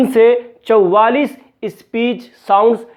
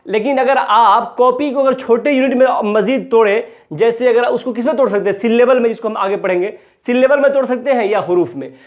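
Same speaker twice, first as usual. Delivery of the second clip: normal talking voice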